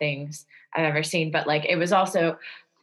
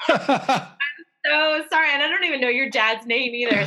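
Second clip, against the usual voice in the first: laughing apology; 7 semitones higher than usual. Real, not acted.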